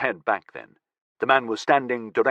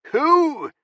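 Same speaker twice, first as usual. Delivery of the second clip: high frightened bellow; 4 dB louder than usual. A narrator's reading. Captive bellowing back at his interrogator, high and frightened. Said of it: "Callum bellowed back".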